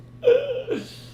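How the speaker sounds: sudden high self-mocking laugh; high embarrassed laugh. Real, not acted. Streamer laughing at his own failure, high self-mocking laugh.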